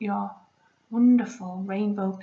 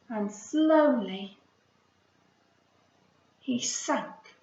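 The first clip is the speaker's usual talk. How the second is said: story narration; slower than usual. A narrator's reading. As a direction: let the words drift down like seeds. Reading story aloud.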